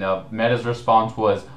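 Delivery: ordinary delivery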